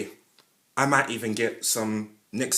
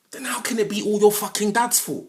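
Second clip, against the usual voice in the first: angry outburst; 8 semitones higher, 4 dB louder than usual. Real, not acted. Ranting about dads.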